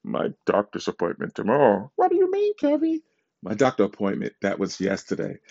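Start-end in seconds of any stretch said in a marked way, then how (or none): 2.0-3.0 squeaky falsetto question; high squeaky voice. Retold or imitated. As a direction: pitch way up, squeaky. Quoting someone, high squeaky.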